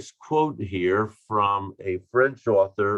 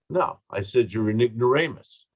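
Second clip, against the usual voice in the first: ordinary talk, nothing marked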